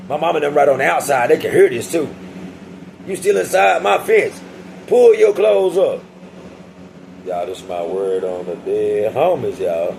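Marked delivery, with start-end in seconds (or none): 3.1-6.0 slow, higher, bossy guard voice; taunting prison guard. Retold or imitated. Guard taunting the inmate at the gate, slow and bossy. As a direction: lord it over the inmate, a bit higher. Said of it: "He talking about".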